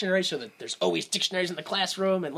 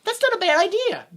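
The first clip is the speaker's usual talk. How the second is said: squeaky high, loud mock approval; a hammed-up high voice pretending to agree; mock agreement; 6 dB louder than usual. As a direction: fake being impressed, way up high. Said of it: "you go, you go".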